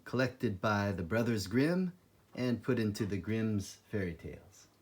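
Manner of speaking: plain reading voice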